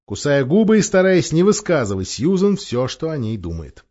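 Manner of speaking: ordinary delivery, nothing marked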